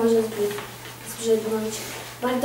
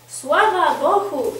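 slow, raised, ringing hail; loud greeting; 4 semitones higher, 5 dB louder, slower than usual. A narrator's reading. Nobleman greeting a blind beggar, loud hail. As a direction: call out higher and louder, drawn out. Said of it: "rzekł głośno szlachcic".

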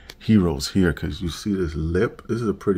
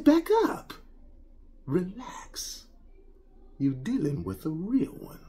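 much higher, showy mock-tough brag; cocky swagger; 12 semitones higher, 3 dB quieter than usual. Telling the wife to back up, cocky swagger. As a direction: put on big swagger. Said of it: "I said".

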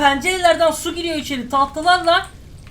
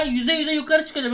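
ordinary delivery

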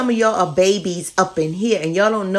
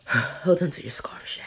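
whisper; 3 semitones lower, 4 dB quieter than usual. Pretend. Whispering before lighting the candle.

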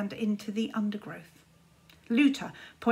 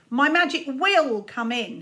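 hissed; 4 semitones higher, 6 dB louder than usual. Acted. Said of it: "hissed Czar".

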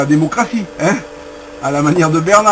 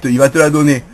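usual speaking voice

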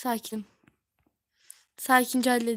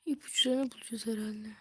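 whisper; 5 dB quieter than usual. Real, not acted. Aside while playing.

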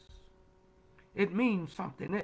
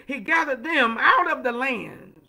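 emphatic proclamation; 2 semitones higher, 6 dB louder than usual. Real, not acted. Preacher hammers the scripture home to the congregation, loud and emphatic. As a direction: proclaim it to the whole church.

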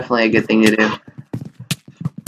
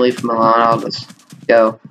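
ordinary talk

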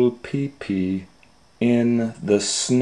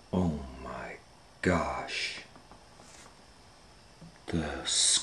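whispered awe; 7 semitones lower, 17 dB quieter than usual. Real, not acted. Excited hushed aside.

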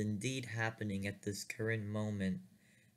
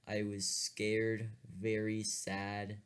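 normal talking voice

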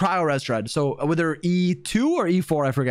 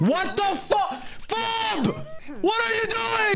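high-pitched shout of disbelief; appalled outcry; 11 semitones higher, slower than usual. Real, not acted. Gamer watching Fob's play, high appalled shout. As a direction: cry out, pitch shooting up.

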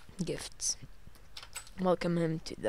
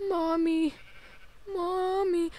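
mock scared cry; 11 semitones higher, 4 dB louder, slower than usual. Scared by a sound.

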